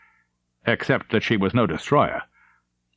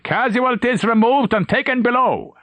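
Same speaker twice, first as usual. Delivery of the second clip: high, raised call across the bridge; yelled report; 13 semitones higher, 3 dB louder than usual. Acted. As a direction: yell it over the gunfire. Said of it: "A voice yelled".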